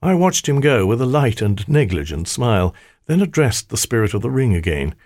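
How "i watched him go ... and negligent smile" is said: usual speaking voice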